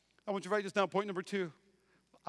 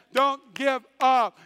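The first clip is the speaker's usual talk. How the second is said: emphatic plea; 3 semitones higher, 8 dB louder, much slower than usual. Acted.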